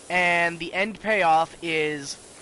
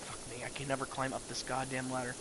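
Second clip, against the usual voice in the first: much softer, quicker, low-pitched grumble; muttered grumbling aside; 5 semitones lower, 12 dB quieter, faster than usual. Acted. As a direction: grumble under your breath, fast and low. Muttered aside amid grandma act.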